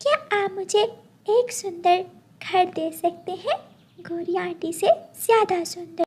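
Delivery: put-on high, small childlike pleading; little doll's voice. The doll asking the magic giver for a pretty house, childlike voice. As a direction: ask sweetly like a hopeful little girl.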